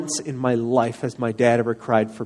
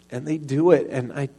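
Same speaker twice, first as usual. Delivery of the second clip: ordinary delivery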